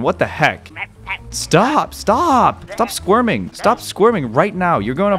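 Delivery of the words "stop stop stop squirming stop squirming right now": high-pitched whining protest; exasperated scolding. Acted. Player holding a squirming puppy, high exasperated scolding. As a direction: plead crossly in a raised pitch.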